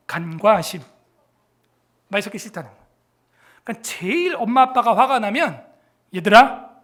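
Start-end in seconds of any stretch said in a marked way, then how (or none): 6.2-6.7 calling out loud. Real, not acted. Calling out to the children.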